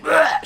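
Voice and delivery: loud, high imitated gag; retching choke. Retelling a woman choking on broth, loud mock retch. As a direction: mimic someone choking in disgust. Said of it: "She starts fucking choking".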